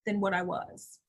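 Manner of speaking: ordinary delivery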